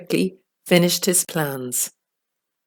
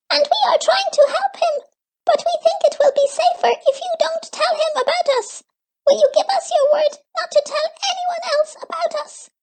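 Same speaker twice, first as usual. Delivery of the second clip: very high, fast little creature; squeaky creature voice; much faster than usual. A narrator's reading. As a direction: chirp it out like a tiny forest creature. Gleek voice held.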